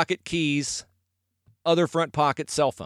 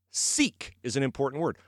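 ordinary talk

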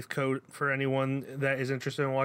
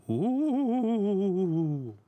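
high eerie ghostly ooh; a haunted-house wail; spooky ride narrator; 7 semitones higher, 3 dB louder than usual. Acted. Spooky intro warning.

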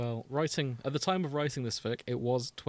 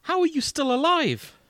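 much higher, louder, shocked princess; astonished princess; 6 dB louder than usual. Acted. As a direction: gasp it out in disbelief. Zelda astonished in scene.

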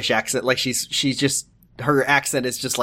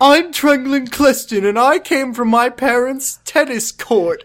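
silly posh character; 9 semitones higher, slower than usual. Acted. Improvised posh character intro.

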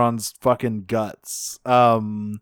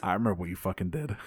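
quiet, low, fast threat; menacing low growl; 5 semitones lower, 11 dB quieter, much faster than usual. Retold or imitated. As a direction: hiss the threat under your breath. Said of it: "he says".

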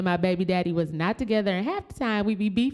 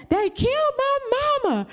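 hysterical news. Retold or imitated. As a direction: blurt it out to your brother. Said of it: "This was my spiel".

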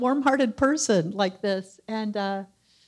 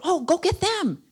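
eager host; 8 semitones higher than usual. Retold or imitated. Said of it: "So he's going".

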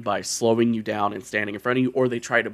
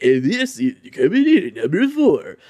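louder, higher hammed-up ranking-video voice; mock streamer hype; 7 semitones higher, 5 dB louder than usual. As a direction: parody a hyped list-video host. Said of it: "where it's like".

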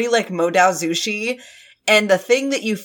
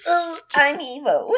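put-on baby voice; 4 semitones higher, slower than usual. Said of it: "Just little baby voice".

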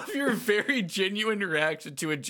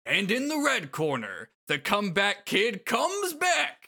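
high, stretched-out ring intro; hyped fight announcer; 8 semitones higher, 3 dB louder, slower than usual. Acted. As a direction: build it up for the crowd. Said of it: "bring to this announcer voice".

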